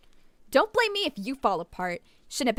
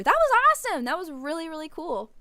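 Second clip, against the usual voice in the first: high, loud thrilled cry; excited; 7 semitones higher, 5 dB louder than usual. Real, not acted. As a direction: burst out with delight. Thrilled by gif plugin.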